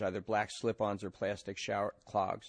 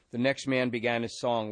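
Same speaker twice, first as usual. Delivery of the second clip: plain reading voice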